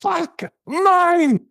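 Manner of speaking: high, stretched-out outburst; hammed-up shout of a fed-up fan; imitating a fan storming off; mock fan yell. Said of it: "he just goes".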